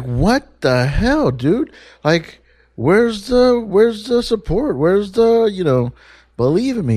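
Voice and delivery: a hammed-up cry of betrayal at a friend; mock offended. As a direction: play up being hurt that nobody believed in you. Retorts to his therapist, mock offended.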